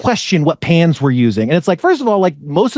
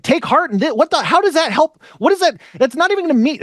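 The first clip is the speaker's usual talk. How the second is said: incredulous disbelief; 13 semitones higher than usual. Retold or imitated. Mocking the motivational guy's line, rising incredulous. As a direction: pitch shot way up in baffled disbelief. Baffled reaction to the advice.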